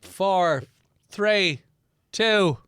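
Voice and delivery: shouting the last number high; excited countdown. Real, not acted. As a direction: build up and hit one loud. Countdown to recording.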